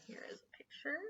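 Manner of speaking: breathy aside, barely voiced; soft whispered aside to listeners. Real, not acted. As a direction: whisper it off-mic. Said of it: "unvoiced whisper".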